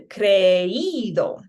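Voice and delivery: "creído" slow, split as cre-EE-do; emphasis on í. Shown as a demonstration. Teacher breaking up the diphthong, stressed í in "creído". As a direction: lean hard on the í in "creído". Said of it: "allows me to put my emphasis just up on".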